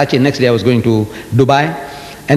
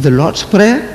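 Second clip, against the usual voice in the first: higher, rising disbelief on "Prayer"; questioning surprise; 4 semitones higher, slower than usual. Retold or imitated. Speaker puzzles over the sticker in the hotel, questioning surprise. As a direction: query "The Lord's Prayer" with a rising end. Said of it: "I said".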